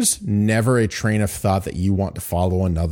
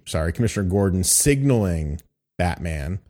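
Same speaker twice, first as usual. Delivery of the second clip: normal talking voice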